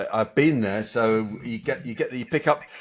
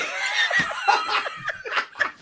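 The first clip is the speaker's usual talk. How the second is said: high, airy burst of laughter; helpless laugh. Real, not acted. Laughing at being taken for a thick French person, helpless. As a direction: crack up at your own story. Laughs at own self-mocking story.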